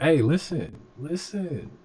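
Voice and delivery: ordinary talk, nothing marked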